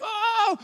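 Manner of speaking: high hammed-up wailing ah; mock wail. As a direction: cry out like a mourning crowd. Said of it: "Semua teriak-teriak". (tiruan jeritan tinggi)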